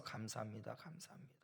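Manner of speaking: breathy whispered thank-yous under the breath. Retold or imitated. Pastor murmuring thanks to God, whispered. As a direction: whisper the thanks as if praying to yourself.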